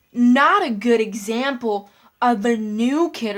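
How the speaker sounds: loud, slow scolding teacher; stern teacher voice. Retold or imitated. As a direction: scold the class like a strict teacher. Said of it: "My teacher said".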